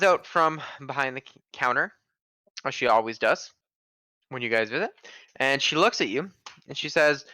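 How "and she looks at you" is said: ordinary delivery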